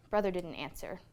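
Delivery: ordinary delivery, nothing marked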